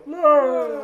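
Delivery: high falling-dummy cry, laughing; high loud no. Retold or imitated.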